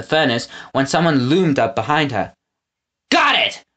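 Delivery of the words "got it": high triumphant roar; snatch it with a cry. A narrator's reading. Gristle grabbing the remote, triumphant roar.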